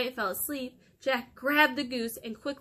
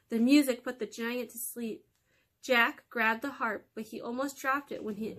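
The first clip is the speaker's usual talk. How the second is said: ordinary delivery, nothing marked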